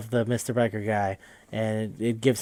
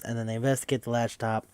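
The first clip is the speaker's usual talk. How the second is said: ordinary talk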